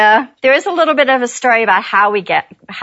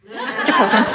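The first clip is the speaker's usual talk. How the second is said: softer, with a laugh in it; laughing repeat; 4 dB quieter than usual. Real, not acted. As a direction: repeat the pun while giggling.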